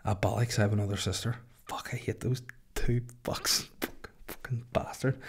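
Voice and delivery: muttered, half-whispered curses of a seething killer; Michael cursing under his breath. Retold or imitated. Locked-up Michael curses his sisters, muttered whisper. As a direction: hiss the swears under your breath, mostly voiceless.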